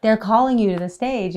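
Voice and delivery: calling out loud. Retold or imitated. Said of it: "And then someone's like".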